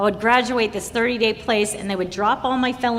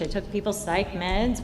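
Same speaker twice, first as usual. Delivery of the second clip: ordinary delivery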